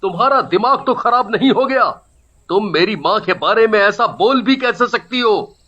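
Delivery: outraged son defending his mother; indignant husband. Acted. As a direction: scold the wife in disbelief. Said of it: "यश को बर्दाश्त नहीं हुई". (माँ के बचाव में बिफरा बेटा)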